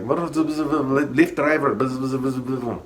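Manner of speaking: high, very slow mocking radio voice; mocking drawl. Coworker mocked calling for a ride over radio, high drawn-out voice. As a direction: stretch out Lyft driver in a mocking high voice. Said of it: "He's like".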